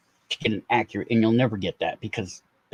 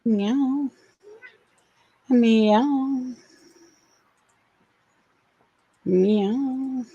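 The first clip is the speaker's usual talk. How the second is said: meowing at cat; 12 semitones higher, much slower than usual. Pretend. Owner meows at her cat in a much higher voice. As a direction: call the cat with high meows.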